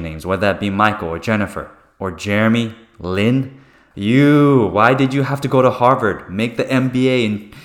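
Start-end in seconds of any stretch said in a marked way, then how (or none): 3.9-7.5 scolding mom. Retold or imitated. Mom's scolding quote, loud.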